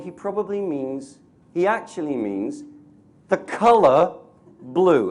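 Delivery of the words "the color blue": loud, ringing stress on color and blue; coloured emphasis. Shown as a demonstration. To the audience, giving the color blue its weight, loudly stressed. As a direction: lean hard into the color blue. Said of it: "invest a word with the color blue".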